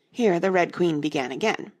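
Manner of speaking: usual speaking voice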